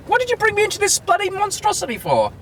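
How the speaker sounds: high-pitched exasperated complaint; indignant, voice shooting up. Real, not acted. Exasperated at chaotic car park.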